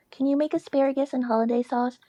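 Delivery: a hint of mom asking a favour; mom's text. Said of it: "my mom would text me".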